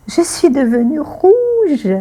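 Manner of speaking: high, tearful bashful line; mock embarrassed "Je suis devenue rouge"; acted shyness. Shown as a demonstration. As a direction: say it as if blushing, voice catching. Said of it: "pour exprimer la timidité".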